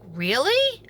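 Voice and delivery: exaggerated questioning rise, as if stunned; mock shock. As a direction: imitate her shocked disbelief. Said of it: "In shock".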